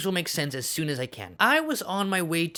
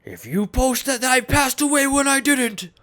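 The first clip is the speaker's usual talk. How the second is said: high, loud, indignant mock yelling; Anthony Fantano; 8 semitones higher, 5 dB louder than usual. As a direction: rise into an outraged accusation. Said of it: "Anthony Fantano says".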